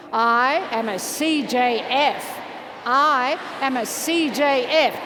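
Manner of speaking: slow, emphatic group chant; heartfelt affirmation. Shown as a demonstration. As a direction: declare it slowly, meaning every word. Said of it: "with real, try to feel it".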